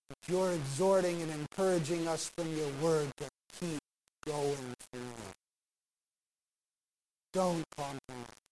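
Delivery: soft, hushed pastoral prayer. Acted. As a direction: pray quietly over the room. Closing prayer over the congregation.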